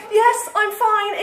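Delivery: high, slow stalling reply; flustered cover-up. A narrator's reading. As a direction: pretend all is fine, voice pitched high. Flustered cover-up through bathroom door.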